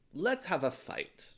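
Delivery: slow, high-pitched mock challenge; mock combative. Imitating people who would start a feud, mock combative. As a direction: propose it with drawn-out relish.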